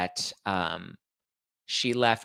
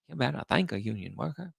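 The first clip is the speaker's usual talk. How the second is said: politician's put-on accent, softer; mock a candidate's put-on accent; Kamala Harris accent; 2 semitones higher, 5 dB quieter than usual. Host mimicking the candidate on union workers, put-on accent. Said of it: "all of her different accents".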